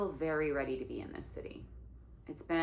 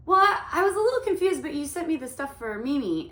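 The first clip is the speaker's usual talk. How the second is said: flustered high; 10 semitones higher, 9 dB louder, faster than usual. Retold or imitated. Flustered young self quoted.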